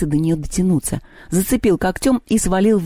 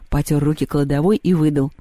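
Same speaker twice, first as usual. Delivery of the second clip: ordinary delivery, nothing marked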